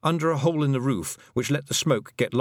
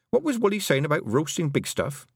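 higher, mildly demanding question; 6 semitones higher than usual. A narrator's reading. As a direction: demand an answer, pitched up. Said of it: "Tiffany demanded".

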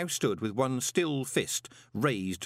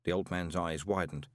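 ordinary delivery, nothing marked

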